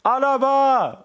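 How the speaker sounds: high, drawn-out shout of the name; crowd call. Acted. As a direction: answer the announcer like a crowd. Crowd answer in goal call.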